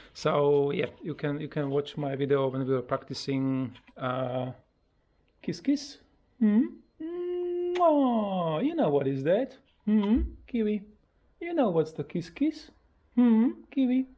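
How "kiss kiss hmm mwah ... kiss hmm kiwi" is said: high cooing with a big kiss smack; pet talk. Real, not acted. Owner prompting parrot to say kiss kiss, high pet voice. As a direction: pitch it way up to prompt a bird.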